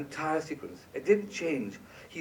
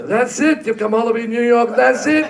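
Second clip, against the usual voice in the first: loud, higher incredulous mock director; hammed-up disbelief, rising; Brian impression; 8 semitones higher, 10 dB louder than usual. Director stunned the composer brought one cue, loud and higher. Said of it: "excuse my terrible Brian impersonation".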